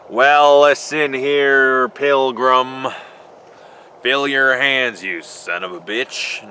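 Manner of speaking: slow, loud cowboy drawl; John Wayne impression. A mock John Wayne growling at a pilgrim, slow cowboy drawl. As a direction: drawl it out like an old Western hero. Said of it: "watched any John Wayne movies".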